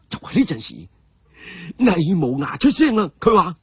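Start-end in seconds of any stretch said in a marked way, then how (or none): none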